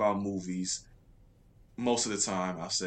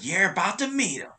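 higher cartoon hedgehog voice; Sonic voice; 8 semitones higher than usual. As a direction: pitch it up like Sonic. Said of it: "But in the Sonic voice".

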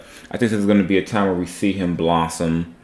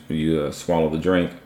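usual speaking voice

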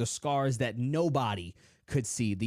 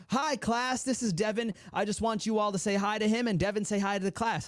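higher-pitched imitation of a teacher; teacher introducing pupil; 6 semitones higher than usual. Teacher introducing a new boy to the class, higher-pitched imitation. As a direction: lightly act a teacher addressing the class, pitched up. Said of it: "the teacher introduced me to everybody".

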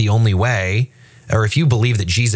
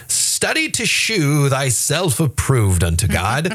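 slow, higher, archaic scripture voice; solemn King James reading; 4 semitones higher, slower than usual. Shown as a demonstration. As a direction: intone it like old Bible English.

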